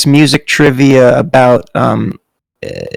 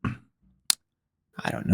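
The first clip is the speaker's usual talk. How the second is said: whispered; 5 semitones lower, 16 dB quieter, much slower than usual. Real, not acted. Unsure whispered answer.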